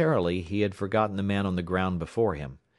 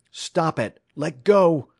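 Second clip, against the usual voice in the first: high, forceful shout building louder; cried command; 9 semitones higher, 8 dB louder, slower than usual. A narrator's reading. Alex holds a revolver on the attacker, crying out commands. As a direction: cry out the order, pitch up. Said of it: "he cried".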